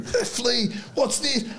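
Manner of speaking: a hammed-up panicked teammate; mock outraged mate. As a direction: squeal it like the shocked mate seeing his lip. Mate swearing at his mangled lip.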